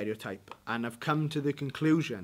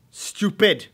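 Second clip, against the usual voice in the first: Stupid called out loud and high; loud translation; 7 semitones higher, 12 dB louder than usual. Shown as a demonstration. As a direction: announce Stupid as the meaning, loud. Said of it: "to give you a new Welsh word".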